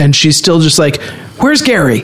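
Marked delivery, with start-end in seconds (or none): 1.4-2.0 high, far-off boss voice; calling for Gary. Retold or imitated. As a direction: call out for the assistant, higher. Imitating Veep boss calling.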